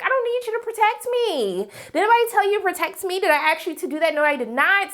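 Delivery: high-pitched acted protest; indignant rebuff. Wife refusing the commander's protection, high and indignant. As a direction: start very high and fire off the questions in mock outrage.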